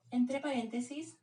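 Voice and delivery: usual speaking voice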